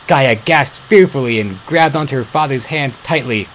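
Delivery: ordinary delivery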